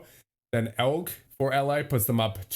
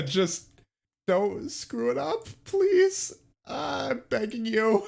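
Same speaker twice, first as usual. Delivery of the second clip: high, slow, pleading falsetto; drawn-out high-pitched plea, almost crying; desperate begging; 11 semitones higher, much slower than usual. Real, not acted. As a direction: beg the team in a squeaky high whine. Desperate plea to his team.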